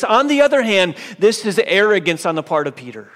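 loud, rising pulpit voice; fervent preaching. Acted. Preacher praising then condemning Peter, loud and rising. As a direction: build and build to the turn.

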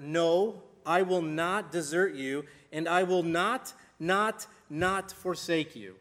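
slow, loud, separate stresses on "not, not, not"; emphatic word-for-word reading. Shown as a demonstration. Preacher showing the original's five negatives, "not, not, not" slowly hammered. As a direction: hammer each "not" in "not, not, not forsake you". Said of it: "it literally says word for word".

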